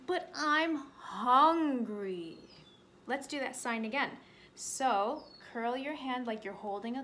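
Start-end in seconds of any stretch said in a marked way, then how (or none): 0.1-2.4 slow, drawn-out bear complaint; hungry bear. Acted. Bear answering the rabbit, slow hungry whine. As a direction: stretch it out like a big hungry bear. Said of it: "And the bear says".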